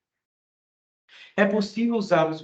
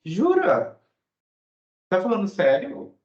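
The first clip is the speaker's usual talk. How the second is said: much higher, excited "Jura?"; amazed surprise; 5 semitones higher than usual. Shown as a demonstration. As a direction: gasp it out in disbelief. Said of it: "expressando surpresa e interesse". (surpresa espantada, voz bem aguda)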